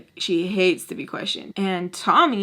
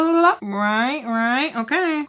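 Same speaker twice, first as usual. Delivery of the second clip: mocking blah-blah; 4 semitones higher than usual. Mimicking back in retold argument.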